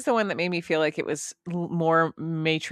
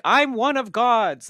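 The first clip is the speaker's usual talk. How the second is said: mock proud believer; 6 semitones higher, 7 dB louder, slower than usual. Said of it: "whipping their penis out and being like".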